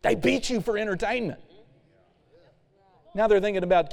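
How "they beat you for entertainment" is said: pine for Egypt's food; whining Israelites. Retold or imitated. Preacher voices Israelites longing for Egypt's food, high and whiny.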